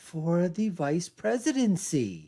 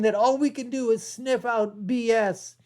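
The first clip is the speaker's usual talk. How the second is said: ordinary talk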